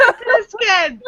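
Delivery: real gleeful exclamation; excited outburst. Real, not acted. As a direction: blurt the name out in glee. Delighted outburst at the joke.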